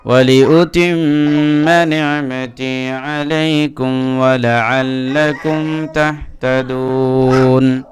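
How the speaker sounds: slow, drawn-out melodic tilawah; Quran recitation. Acted. Teacher opens the lesson by reciting the verses, melodic and slow. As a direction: chant each verse slowly with long held vowels.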